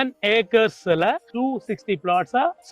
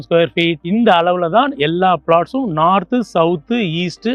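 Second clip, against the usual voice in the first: sales pitch; 6 dB louder than usual. Acted. Loud promotional sales pitch.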